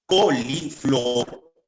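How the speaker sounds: coliflor drawn out as co-li-flor; dictation-style coliflor; syllable by syllable. Shown as a demonstration. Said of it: "al momento de escribir".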